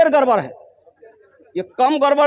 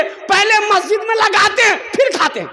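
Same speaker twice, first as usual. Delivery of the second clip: high-pitched mock shout; acted, worked-up self-defence; indignant insistence; 10 semitones higher than usual. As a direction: protest innocence at the top of your range. Accused man insists again, worked up.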